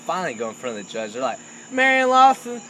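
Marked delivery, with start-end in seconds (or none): 1.7-2.6 called out loud and high like a court clerk; calling out a name. Retold or imitated. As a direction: announce the name to the room, clerk-style.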